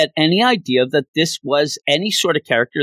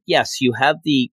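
ordinary talk, nothing marked

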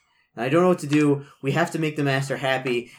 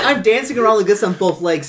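normal talking voice

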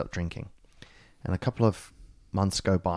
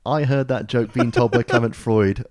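louder, breaking into a laugh; laughing through it; 4 semitones higher, 10 dB louder than usual. Real, not acted. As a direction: crack up as the line builds to its end. Cracking up after his own punchline.

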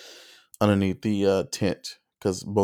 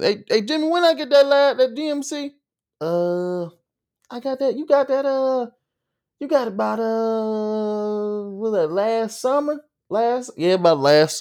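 much higher hammed-up Southern good ol' boy; high mock country guy; 13 semitones higher than usual. Country guy calling to Jimmy about the GMC, much higher hammed-up Southern voice.

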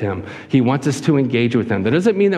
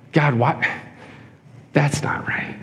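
near-whispered complaint to God; hushed protest; 4 dB quieter, slower than usual. Acted. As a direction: mutter your objection under your breath. Acted muttered protest to God.

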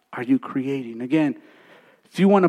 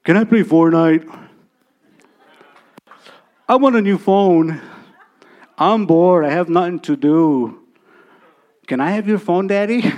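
put-on louder whiny kids pestering dad; imitating his children nagging for a phone; kids' nagging; 4 dB louder than usual. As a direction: pester like bored kids begging. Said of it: "I don't wanna be bombarded with".

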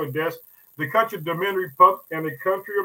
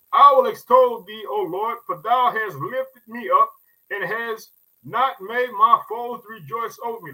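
psalm declared from the pulpit; preaching the verse; 4 semitones higher than usual. Acted. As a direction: proclaim it to the church.

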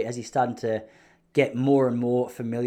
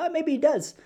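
much higher put-on wife voice; airy, pitched-up impression of his wife; imitating wife; 13 semitones higher than usual. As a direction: go up high for a mock-coy wife. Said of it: "I don't know why she spoke like that".

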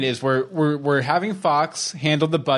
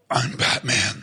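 Batman voice; slower than usual. Retold or imitated. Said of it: "You wanna have a Batman voice contest".

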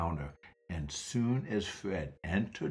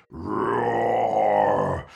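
louder roar; loud lion roar; 6 dB louder than usual. Acted. Growling louder.

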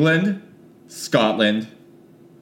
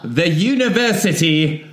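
higher, put-on English accent; shitty English accent; 4 semitones higher than usual. Re-reading the university's name, mock English accent. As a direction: play a bad English newsreader. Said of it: "in our shitty English accents".

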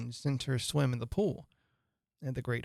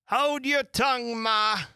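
high, louder, cheeky retort; snappish young crab; 9 semitones higher, 5 dB louder, slower than usual. A narrator's reading. Young Crab answers her mother back, high and snappish. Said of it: "a little snappishly".

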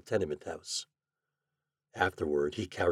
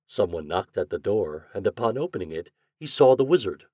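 ordinary delivery, nothing marked

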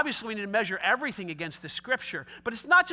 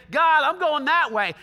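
defiant declaration; 4 semitones higher, 7 dB louder than usual. Retold or imitated. Defiant person tells God.

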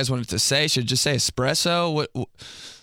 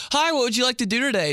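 cheery teller; 11 semitones higher, faster than usual. Retold or imitated. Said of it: "the bank teller was like".

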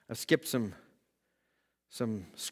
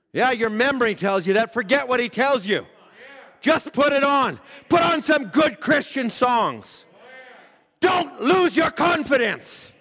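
higher, rising exhortation with shouts; preaching at full force; 10 semitones higher, 5 dB louder than usual. Acted. Preacher urging listeners to put on worship music, preaching hard. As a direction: drive it home like a call to action.

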